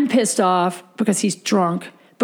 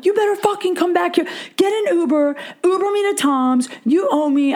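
higher, fast, furious replay of the call; angry demand; 7 semitones higher than usual. Retold or imitated. Ordering the drunk boyfriend back to drive her, higher and fast. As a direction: snap the orders at him fast and high.

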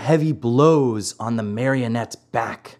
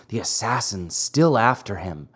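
ordinary delivery

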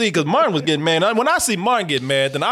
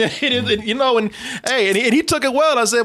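ordinary delivery

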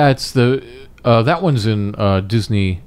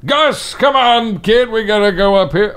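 cranky cartoon grandpa calling his buddy; Carl from Up voice; 10 semitones higher than usual. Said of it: "I'm the voice of the character of Ed Asner's guy".

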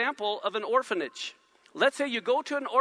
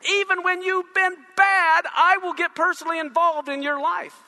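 raised, slowed and forceful, God pleading with the wayward; God's fervent promise; 6 semitones higher, 5 dB louder than usual. Acted. Preacher voicing God to the congregation, raised and fervent. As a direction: lift it high and insist, as God reaching out.

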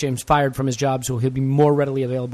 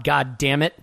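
ordinary talk

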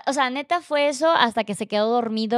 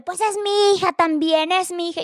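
imitated yelling; 8 semitones higher than usual. Father yells back that she is his child too, imitated holler. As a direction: draw out his indignant yell, pitched way up. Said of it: "empieza a gritar de".

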